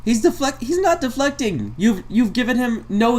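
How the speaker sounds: high-pitched indignant outburst; exasperated protest. Real, not acted. Reactor rebutting the clip's claim, high exasperated protest. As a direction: object in a squeaky, incredulous pitch.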